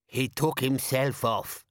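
put-on slow, cutting older woman; snapped. A narrator's reading. Older woman correcting Nobby, snapping. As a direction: snap it with heavy reproach. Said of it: "snapped an older woman".

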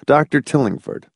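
usual speaking voice

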